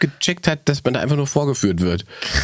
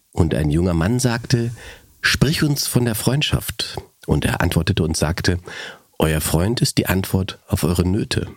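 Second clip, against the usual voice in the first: slow, low solemn recital; poem reading; 4 semitones lower, slower than usual. Acted. Reading Gibran on friendship to her friend, solemn and slow. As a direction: read it like scripture, unhurried.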